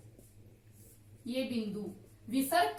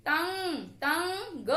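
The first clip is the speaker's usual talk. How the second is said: तंग drawn out slow and raised, nasal ending held; slow sounding out; 4 semitones higher, much slower than usual. Shown as a demonstration. Teacher drags तंग for the class. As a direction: stretch तंग so the anusvar rings. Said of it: "त के बाद अनुस्वार की आवाज आ रही है".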